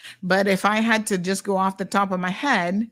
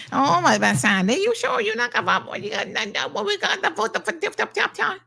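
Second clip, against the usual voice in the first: much higher mocking falsetto, sing-song; 8 semitones higher than usual. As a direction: parody the chatter in a high, teasing voice. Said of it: "Not gonna say".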